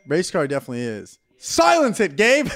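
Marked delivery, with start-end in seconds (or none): 1.4-2.5 loud high yell at Gabe; shouting. Real, not acted. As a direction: bark it out across the room. Yelling at friend.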